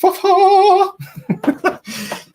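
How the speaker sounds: very high, loud, drawn-out cackling howl; hammed-up exasperated laugh. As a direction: let out a mock-maddened shrieking laugh. Mock rant at Google Chrome.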